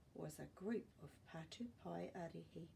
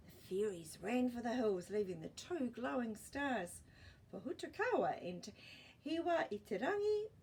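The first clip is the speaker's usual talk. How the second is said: ordinary delivery, nothing marked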